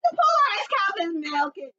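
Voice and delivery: high, loud frantic cry; panicked. Acted. Fox panicking about melting ice, high and frantic. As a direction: blurt it in a frenzy.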